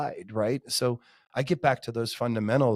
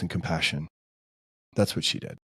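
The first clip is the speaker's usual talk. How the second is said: getting emotional; 5 semitones lower than usual. Real, not acted. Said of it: "can I get a little emotional".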